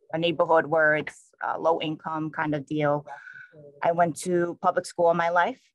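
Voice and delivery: ordinary talk, nothing marked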